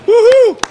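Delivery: very high, loud woohoo; excited whoop. Real, not acted. Commentator cheering the team, high whoop. Cheering the team's finished run.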